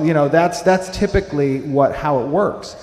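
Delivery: usual speaking voice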